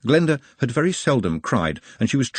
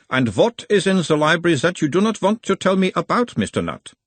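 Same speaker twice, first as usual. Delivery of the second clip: put-on a mild Uberwaldian accent, the higher voice of a gentle examiner; 9 semitones higher than usual. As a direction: question him in a light Uberwald accent, calming the patient. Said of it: "talking like someone from Uberwald".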